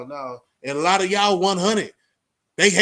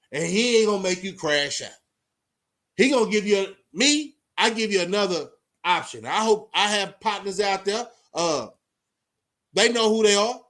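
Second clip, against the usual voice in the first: ordinary delivery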